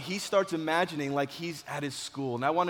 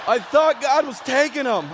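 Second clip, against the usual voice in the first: high, loud, worked-up exclamation; comic alarm; 8 semitones higher, 7 dB louder than usual. Retold or imitated. Storyteller telling audience why he panicked, comically alarmed. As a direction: explain the panic, still at a high pitch.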